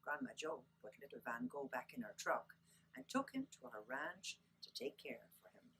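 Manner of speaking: usual speaking voice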